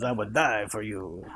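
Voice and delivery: low, soft crooning suitor; smooth it out like a lover; romantic accent. Retold or imitated. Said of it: "You need to perfect that accent too".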